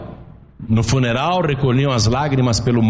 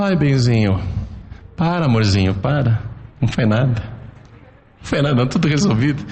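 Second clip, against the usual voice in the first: tender comforting; 4 semitones lower, faster than usual. Retold or imitated. Father comforting crying daughter Bianca, lower and tender. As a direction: coo the daughter calm, lightly. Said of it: "Ela chorou".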